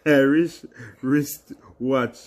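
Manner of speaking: slow, overcareful tongue twister; very slow try. Shown as a demonstration. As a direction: drag out each word slowly. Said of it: "Say it fast".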